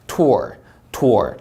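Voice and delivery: "tour" ending in a full "er"; R sound. Shown as a demonstration. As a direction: curl into the R of "tour". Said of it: "er 발음 제대로 하셔야 됩니다". ("tour"의 R을 살려 발음)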